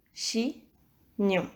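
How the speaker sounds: Chignon. slowly cut in two; two separated syllables in Chignon. Shown as a demonstration. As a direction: repeat "Chignon." as chi, pause, gnon. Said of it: "Il y a deux syllabes".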